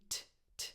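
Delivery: whispered puffs of t, t, voiceless; aspirated t. Shown as a demonstration. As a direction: breathe out a crisp t, t. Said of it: "if you fix this T".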